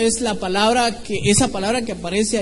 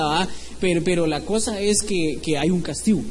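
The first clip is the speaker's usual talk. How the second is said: ordinary talk, nothing marked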